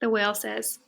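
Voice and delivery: ordinary delivery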